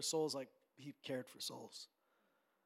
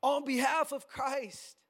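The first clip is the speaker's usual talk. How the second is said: louder, emphatic sermon delivery; forceful preaching; 6 semitones higher, 8 dB louder than usual. Real, not acted. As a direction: drive each phrase home to the congregation.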